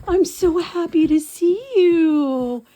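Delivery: high, slow, loud cooing to the dog; stretched high dog-greeting voice; slow puppy talk. Real, not acted. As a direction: draw it out for the puppy.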